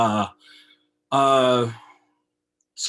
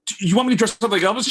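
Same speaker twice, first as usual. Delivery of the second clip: higher, louder, fast and eager; excited eager question; 9 semitones higher, 8 dB louder, much faster than usual. Retold or imitated. Retelling asking the bride about Elvis, eagerly excited. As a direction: blurt it out, thrilled at the idea.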